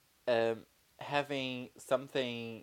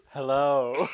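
louder, sing-song phone greeting; a hammed-up Hello on the phone; mock phone hello; 3 semitones higher, 9 dB louder than usual. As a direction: answer the phone with a showy Hello. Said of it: "doesn't sound like you're making a normal Rogerio".

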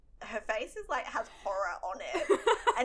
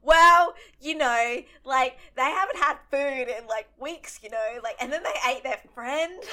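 casual explaining; 3 semitones higher, 10 dB louder than usual. Retold or imitated. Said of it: "And I was just like".

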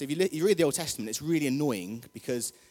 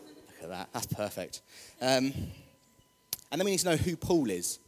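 ordinary delivery

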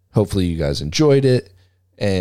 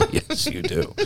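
laughing; slower than usual. Real, not acted. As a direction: say it through a laugh. Laughing reply.

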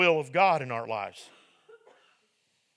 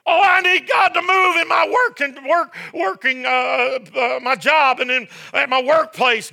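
mock pleading; 11 semitones higher, 7 dB louder than usual. Voicing an urgent plea to God.

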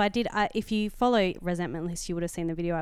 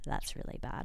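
grim hushed verdict; 7 semitones lower, 10 dB quieter than usual. Retold or imitated. Exterminator's grim verdict on cockroaches.

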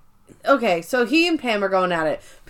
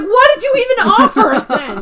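much higher and louder, laughing; exasperated Pam; 11 semitones higher, 8 dB louder than usual. Retold or imitated. Pam exasperated at Michael's backpedaling, high loud laugh. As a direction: throw up your hands in disbelief. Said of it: "And she's like".

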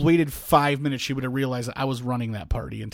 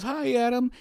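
high-pitched imitated hello; mock polite greeting; 10 semitones higher, slower than usual. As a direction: imitate a lady's sing-out greeting. Acting the lady greeting Adam.

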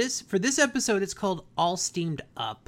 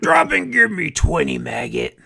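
louder barked drill order; drill sergeant; 4 semitones lower, 7 dB louder than usual. Retold or imitated. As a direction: bark it like a boot camp sergeant. Sarge voiced barking an order.

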